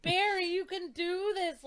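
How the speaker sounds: emphatic retold shout; shouted encouragement. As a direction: cheer the hero on at the screen. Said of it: "he needed me to shout at the screen".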